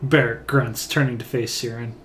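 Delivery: ordinary delivery, nothing marked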